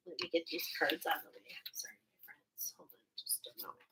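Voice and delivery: quick hushed whisper while tidying cards; whispered aside. Real, not acted. Reader pushes cards aside, whispering sorry to her friends. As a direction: murmur an apology to viewers, rushed and unvoiced.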